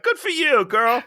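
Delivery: put-on high, loud girlfriend cheer; mock hype for her outfit; sassy cheer. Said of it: "And I was like".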